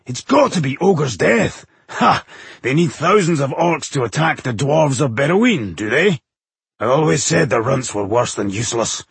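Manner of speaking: eager character voice, starting high and settling; excited dwarf. A narrator's reading. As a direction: crow over it like a battle-hungry dwarf. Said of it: "Bowindil shrieked excitedly".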